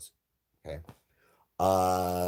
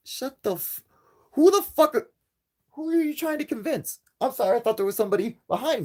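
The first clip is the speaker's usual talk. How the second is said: much higher, strained, disbelieving yell; incredulous outburst; 3 dB louder than usual. Real, not acted. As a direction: shout him down in total disbelief. Exasperated outburst at a liar.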